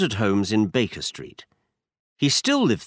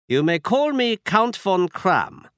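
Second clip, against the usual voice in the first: put-on German accent; 8 semitones higher than usual. A narrator's reading. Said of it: "He had a strong German accent".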